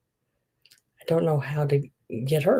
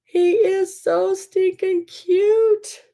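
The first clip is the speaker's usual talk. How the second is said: cooing squeal; 3 dB louder, much slower than usual. Real, not acted. Grandma coos at the baby picture, high and slow. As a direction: melt over the photo, stretching every word.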